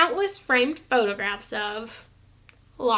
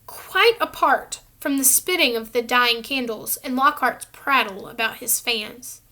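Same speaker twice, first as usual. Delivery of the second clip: usual speaking voice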